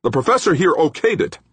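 loud, very high-pitched protest; angry screech. A narrator's reading. Engineer defending his design, angrily screeching. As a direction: screech it in furious self-defense. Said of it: "angrily Obermann screeched".

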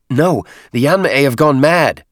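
loud, high-pitched protest; heated cry of defiance; agitated refusal. Acted. Agitated nephew refuses the order.

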